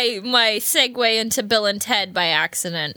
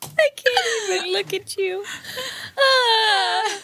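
hammed-up old-fashioned wail, higher pitched; mock archaic lament; 8 semitones higher, slower than usual. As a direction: cry out like a tragic lady of old. Archaic banter role play.

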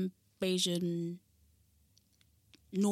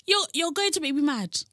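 raised, louder mock-angry warning; exasperated; 7 semitones higher, 4 dB louder than usual. Woman fed up with her mangled surname, exasperated. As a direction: warn them, voice pushed up high. Exasperated at people getting name wrong.

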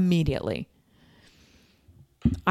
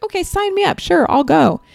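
much higher and louder, gushing agreement; eager yes; 12 semitones higher, 9 dB louder than usual. Retold or imitated. Telling how she jumped at the idea, excitedly. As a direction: blurt it out like you can't wait.

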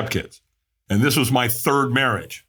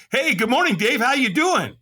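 cheery sponsor; 11 semitones higher than usual. Retold or imitated. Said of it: "he's like".

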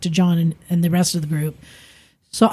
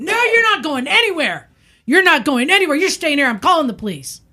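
retold screaming; 8 semitones higher, faster than usual. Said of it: "And he's just screaming".

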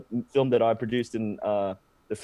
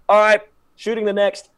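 loud, higher-pitched set announcement; assistant director calling out; 7 semitones higher, 6 dB louder than usual. Retold or imitated. First assistant director moving the shoot on, calling out. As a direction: call it out to the whole crew. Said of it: "first assistant director, he's just like".

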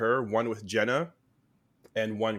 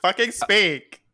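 annoyed snap; 9 semitones higher, 7 dB louder than usual. Acted. Becky snapping at Brody.

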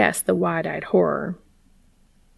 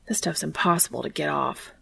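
quiet grumble, softer than usual; muttered; 3 semitones lower, 7 dB quieter than usual. A narrator's reading. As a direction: grumble it under your breath. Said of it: "he muttered".

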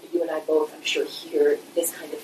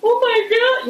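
high, loud mock-weeping outburst; retold weeping; 6 dB louder than usual. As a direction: imitate being overcome, pitched right up. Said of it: "weeping and being like".

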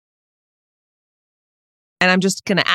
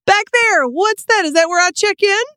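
high, eager new employee; new worker asks the manager where to check in, high and eager; high nervous new-hire voice; 11 semitones higher than usual. Acted.

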